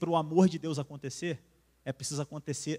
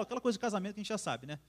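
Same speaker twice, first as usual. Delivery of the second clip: ordinary talk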